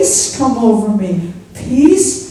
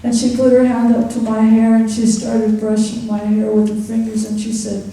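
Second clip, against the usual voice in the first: ordinary talk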